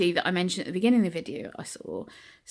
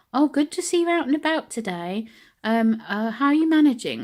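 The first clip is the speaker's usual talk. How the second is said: high, louder, sugary concern; patronising shop lady; 5 semitones higher, 5 dB louder than usual. Retold or imitated. Shop lady greeting a wheelchair user, mock sugary high. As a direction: mimic a condescending lady.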